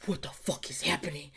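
panicked whisper; startled whisper; frozen whisper. Retold or imitated. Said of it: "I'd be, like, frozen".

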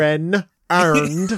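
slow, drawn-out mock Baltimore "Aaron earned"; Baltimore accent. Echoing the video slowly, in a mock Baltimore accent. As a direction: stretch it Baltimore style. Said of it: "We really talk like that?".

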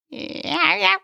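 small mock animal cry; guessed raccoon noise. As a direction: try out a little raccoon call. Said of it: "maybe they go like".

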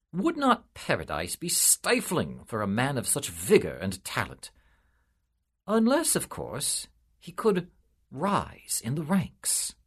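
ordinary delivery, nothing marked

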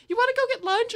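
high, chirpy mimicked invite; perky invitation. Friend inviting a crying girl to lunch, perky mimicry. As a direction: pitch it up like an eager friend.

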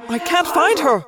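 strained thought-speak call; frustrated yell. A narrator's reading. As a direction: report failure with exasperation. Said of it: "Axe yelled in frustration".

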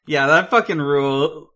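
ordinary talk

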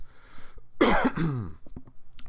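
real cough, clearing the throat. Real, not acted. Podcaster still getting over sickness, throat-clearing cough. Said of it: "the coughing and the clearing of the throat".

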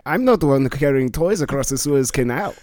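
put-on protesting German accent; comic German accent. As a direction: retort as the German sailor. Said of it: "I'm from West Germany".

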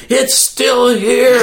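high, slow, drawn out; dramatic retold relief, slow and high; mock amazement. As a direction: slowly marvel that it is still there. Checking head after blade falls.

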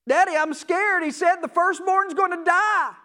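frightened son pleading; scared child. Acted. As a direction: cry out to daddy in fear. Firstborn son fears death.

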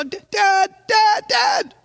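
put-on high, loud boyish cry of Dad; child yelling. Little boy yelling after his dad's truck. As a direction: yell after the departing truck. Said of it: "I can't yell too loud".

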